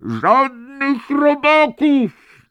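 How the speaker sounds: very high, loud, slow howl. A narrator's reading. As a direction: howl it out in terror. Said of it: "zawył George". (przeciągłe, wysokie wycie)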